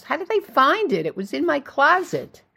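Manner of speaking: high-pitched dismayed question; acted dismay, voice jumping up; appalled disbelief. A narrator's reading. Hostess learns of soiled guitar, appalled disbelief.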